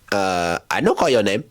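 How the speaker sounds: low, accented clerk imitation; lightly voiced clerk. Front desk clerk denying the page to a passenger, low mock voice. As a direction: play a clerk calmly denying it, pitched low.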